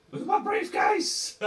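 high, slow squeaky voice. Acted.

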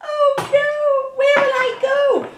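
hammed-up storybook despair; wailing princess. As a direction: lament helplessly at being thrown out. Banished princess wails.

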